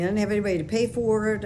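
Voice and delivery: usual speaking voice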